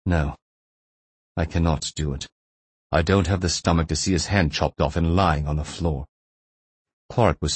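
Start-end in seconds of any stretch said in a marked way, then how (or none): 0.0-6.1 subdued, reluctant captor backing down; sighing reluctance. A narrator's reading. Captor who has no stomach for cutting, subdued reluctance. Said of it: "Kovalenko sighed".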